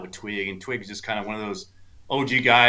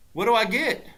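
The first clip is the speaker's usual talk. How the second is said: high-pitched bargaining voice; high retold haggle; imitated old caddy; 12 semitones higher than usual. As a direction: play the caddy angling for more. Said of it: "And Twig's like".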